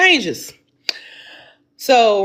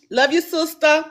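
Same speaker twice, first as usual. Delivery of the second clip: ordinary talk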